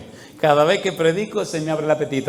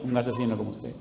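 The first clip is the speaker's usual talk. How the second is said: low, soft, calm reply; understated calm echo; quiet retort; 4 semitones lower, 9 dB quieter than usual. Retold or imitated. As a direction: hand it back quietly, voice dropped. His quiet reply to the shouting.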